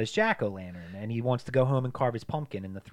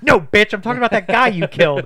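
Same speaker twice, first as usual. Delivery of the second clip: high, very loud yell; mock angry note; 11 semitones higher, 16 dB louder than usual. Retelling the Scary Movie gag, a loud mock yell. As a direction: hurl the line at her. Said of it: "It's like".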